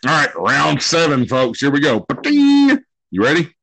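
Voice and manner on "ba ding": 1 ba-ding chime. Pretend. Game show sound effect.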